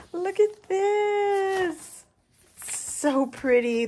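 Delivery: very slow, high coo; delighted squeal. Real, not acted. Crafter revealing the next piece to viewers, squealing with delight. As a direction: stretch each word in high-pitched delight.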